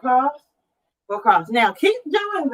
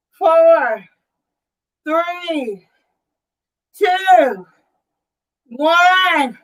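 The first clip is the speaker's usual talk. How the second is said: upbeat rep countdown, count it out; aerobics instructor; 3 semitones higher, much slower than usual. Real, not acted. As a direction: call the count like a fitness coach. Counting reps.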